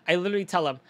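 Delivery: ordinary talk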